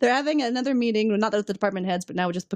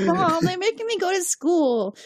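put-on much higher and slow, whining kid; whiny teen; 10 semitones higher, slower than usual. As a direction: whine it like a sulky teenager. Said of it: "whines to his mom".

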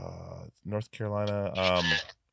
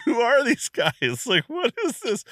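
bewildered laugh; 12 semitones higher than usual. Retold or imitated. Retelling his bewildered reaction.

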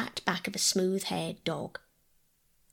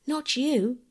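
mild shout; 6 semitones higher than usual. A narrator's reading. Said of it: "he shouted to Bobby".

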